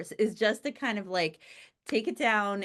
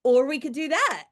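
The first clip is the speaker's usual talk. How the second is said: bright, eager, louder; chirpy up-in-the-clouds idea; self-mocking excited suggestion, higher and louder; imitating herself; 6 semitones higher, 8 dB louder than usual. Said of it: "And I'm just like".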